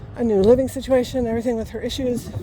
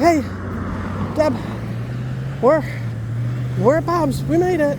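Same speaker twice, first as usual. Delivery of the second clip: lightly voiced dad, a little higher; dad on the phone; 4 semitones higher than usual. Dad calling his daughter on arrival, lightly imitated. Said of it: "yeah, that's my dad".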